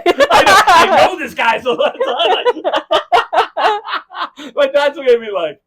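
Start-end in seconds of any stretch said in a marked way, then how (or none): 0.0-2.0 loud, high, through real laughter; laughing agreement. Real, not acted. Laughing along at listener's joke. 2.0-4.5 long, loud, high laugh; uproarious laughter. Real, not acted. Everyone cracking up.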